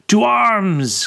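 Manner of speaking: loud, high alarm call; crying out. A narrator's reading. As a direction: shout it across the yard. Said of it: "Kasejian cried again".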